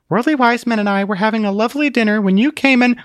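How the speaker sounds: high, sharp complaint; annoyed lady snapping; irritated scolding. A narrator's reading. Truth scolds Zealot.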